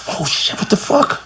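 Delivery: whispered shocked curse under the breath; stunned whisper. Retold or imitated. Kid hearing the new record, whispering in shock. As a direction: breathe it out, half to yourself, stunned. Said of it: "And I'm like".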